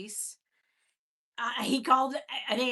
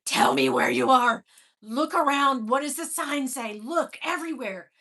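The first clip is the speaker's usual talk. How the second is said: loud retold phone urging; urgent uncle; 9 dB louder than usual. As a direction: urge her like a desperate uncle.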